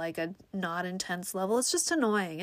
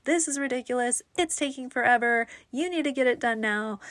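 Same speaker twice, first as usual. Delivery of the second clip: higher, insistent mock telling-off; assertive complaint; 5 semitones higher, 4 dB louder than usual. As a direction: play at being the demanding caller. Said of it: "if I was a different type of person".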